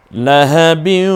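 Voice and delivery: লাহাবিউ drawn out slowly with a humming nasal ghunnah; nasalized ghunnah demo. Shown as a demonstration. Teacher showing students the ghunnah, sustained and nasal. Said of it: "গুণনা করে পড়বেন".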